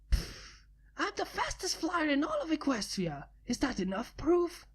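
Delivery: put-on scoff, then boast like a sporty girl; cocky flyer scoffing at a challenge; lightly voiced cocky pegasus. Rainbow Dash boasting.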